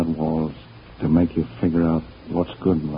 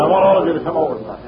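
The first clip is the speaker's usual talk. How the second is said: eager repeat; 11 semitones higher, faster than usual. Acted. Repeating invitation to all.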